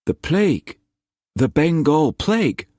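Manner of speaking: high, mildly puzzled reply. Acted. Nephew telling his uncle about the plague, puzzled. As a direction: answer as if surprised they don't know.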